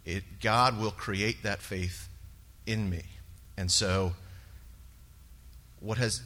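ordinary talk, nothing marked

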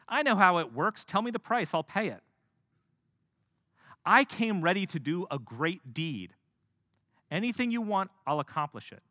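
name your price like a rich noble; a touch of haughty insistence; proud bargaining. Acted. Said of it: "I think he's probably thinking inside".

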